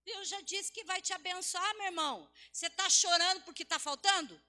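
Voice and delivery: scolding sermon delivery; forceful preaching. Real, not acted. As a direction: challenge the congregation at full voice.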